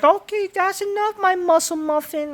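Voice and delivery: mock female wrestler; high mock woman's voice. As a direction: squeak it out like a flirty diva. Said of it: "She says, like".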